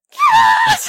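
very high, loud reenacted shriek; exasperated scream. Mom at wit's end answers sons, retold screech. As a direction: scream it, completely fed up. Retold mother at breaking point.

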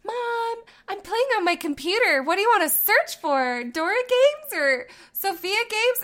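high, loud, bright play voice; imitating daughter. Daughter calling mom to play on her invisible computer, high-pitched. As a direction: play the little girl on her pretend computer. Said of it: "she's always like".

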